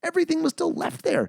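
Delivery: very high-pitched protest; exasperated disbelief. Real, not acted. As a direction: squeal it in baffled outrage. Exasperated rant at plot holes.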